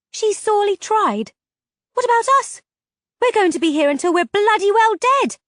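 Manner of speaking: high, loud indignant rant; outraged protest; indignant outburst. A narrator's reading. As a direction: rage at the unfairness. Heroine outraged at being imprisoned.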